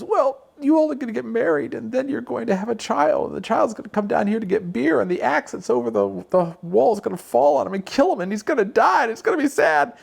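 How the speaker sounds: mother in the fool story foretelling doom, high lament; high wailing mother. Retold or imitated. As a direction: wail it out like a fretful mother foreseeing tragedy. Mother voiced in told story.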